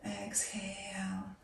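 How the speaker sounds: slow soft whisper; drawn-out whispered exhale. Acted. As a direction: let the word out slowly on the breath. Whispered breathing cue.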